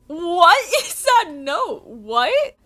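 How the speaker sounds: very loud, high, incredulous cry; real disbelief. Real, not acted. Reader finding her own annotation, loud high disbelief. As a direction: shout it, baffled by your own note.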